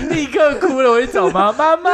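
hammed-up child whimper; mock crying kid. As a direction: play the kid running to mom.

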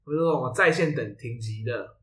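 ordinary talk

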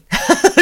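quick amused laugh, high and loud; short laugh. Real, not acted. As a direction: laugh fondly at the cat. Laughs at the cat's eagerness. (开心地笑一下)

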